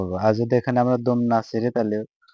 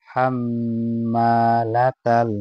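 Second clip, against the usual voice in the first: slow, drawn-out chanted recitation; slow tajweed recitation in Arabic; melodic Quran recitation; much slower than usual. Shown as a demonstration. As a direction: chant each syllable long and sung. Recites with ghunna.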